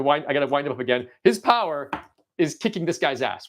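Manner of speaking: normal talking voice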